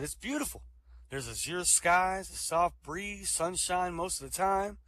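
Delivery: slow, high, dreamy description. Acted. Dead lover describes the afterlife to Martha, slow and dreamy. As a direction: savor each word like paradise.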